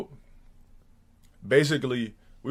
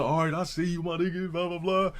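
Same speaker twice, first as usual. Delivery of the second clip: fake friendly; 6 semitones higher than usual. Fake friends' compliments, retold.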